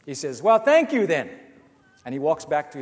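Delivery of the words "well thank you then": loud, higher sarcastic retort; loud sarcastic thanks. Retold or imitated. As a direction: snap it back loudly as you turn away. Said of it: "in a really loud voice".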